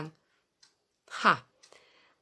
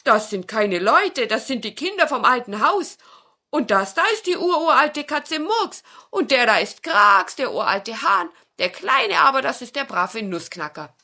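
louder, higher introductions; proud old dog; 6 semitones higher, 9 dB louder than usual. Acted. Dog introduces friends to the fox, proud voice. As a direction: present each friend proudly.

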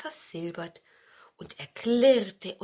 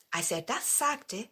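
plain reading voice

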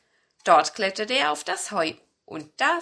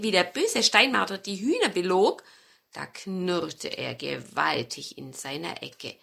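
ordinary delivery

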